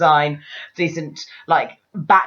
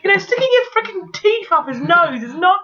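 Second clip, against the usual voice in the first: very high, indignant squeal; outraged squeal; 12 semitones higher than usual. Retold or imitated. Yelling at the film, very high indignant squeal. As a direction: squeak the complaint at the screen. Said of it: "And I'm like".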